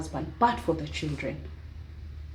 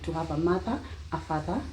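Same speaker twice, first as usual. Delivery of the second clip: ordinary delivery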